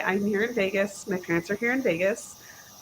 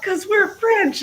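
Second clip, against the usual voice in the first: higher and slow, a hint of a naive kid; mock-innocent child voice; childish insistence; 12 semitones higher, 5 dB louder, slower than usual. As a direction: state it like an earnest little kid. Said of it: "I'm like".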